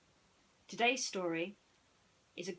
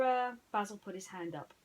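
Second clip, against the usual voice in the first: ordinary delivery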